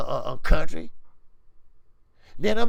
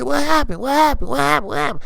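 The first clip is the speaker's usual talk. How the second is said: higher, puzzled voices asking over and over; mock confused crowd; 8 semitones higher, faster than usual. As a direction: play the baffled fans when the music cuts out. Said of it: "They gonna say".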